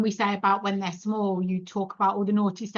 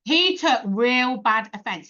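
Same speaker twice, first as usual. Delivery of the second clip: ordinary talk, nothing marked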